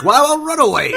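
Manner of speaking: high, very loud hammed-up proclamation; big cheesy movie-title yell; mock dramatic shout. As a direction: belt out the name in mock triumph. Acts out the hero's naming moment.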